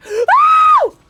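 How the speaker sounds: very high, loud drawn-out yowl; gasp, then shriek as if struck; pained scream. Real, not acted. Player hit during the fight, shrieking in pain. Player gets hurt in game.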